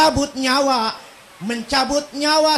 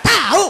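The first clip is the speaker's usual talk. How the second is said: "Tahu" high and loud, Betawi style; Betawi intonation; 7 semitones higher, 6 dB louder than usual. Shown as a demonstration. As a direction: throw out "Tahu" as a loud Betawi exclamation meaning no idea. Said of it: "kalau Betawi ngomong". ("Tahu" dengan intonasi Betawi, tinggi dan kencang)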